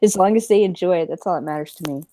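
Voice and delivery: normal talking voice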